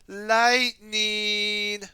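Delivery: loud, high battle cry; sore-voiced cry. Jay calling his element for the tornado, loud cry. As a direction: belt the element out.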